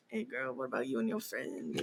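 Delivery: low, hushed smooth talker; put-on charm. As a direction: imitate a sweet-talker, very low and soft. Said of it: "he turned on his charm".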